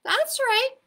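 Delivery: high, loud, upbeat dad; cheery daddy reply. A narrator's reading. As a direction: answer your toddler brightly, missing the point. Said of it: "replied her daddy".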